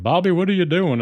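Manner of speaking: irritated cartoon dad, high and loud; exasperated put-on dad voice; Hank Hill. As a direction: imitate a dad snapping at his son. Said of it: "Hank just gets so irritated".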